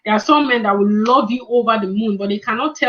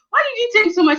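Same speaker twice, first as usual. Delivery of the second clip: surprised; 11 semitones higher than usual. Retold or imitated. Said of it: "I was like".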